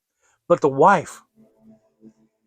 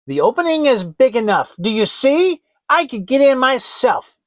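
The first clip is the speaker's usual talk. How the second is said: higher, louder impatient witch; 8 semitones higher, 7 dB louder than usual. Acted. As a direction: show her the way like a cranky hag. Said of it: "said the old woman".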